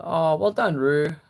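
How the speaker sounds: real proud cheer for someone; delighted praise. Real, not acted. Streamer praising a viewer's goal, delighted.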